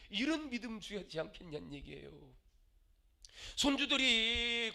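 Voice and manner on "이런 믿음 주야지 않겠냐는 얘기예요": ordinary delivery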